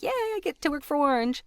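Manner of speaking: high-pitched little cheer of excitement; excited. Retold or imitated. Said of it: "I was super excited".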